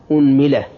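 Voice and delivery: "unmila", opening on u with i after the m; damma, then kasra. Shown as a demonstration. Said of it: "ضم الهمزة". (أنملة بضم الهمزة وكسر الميم)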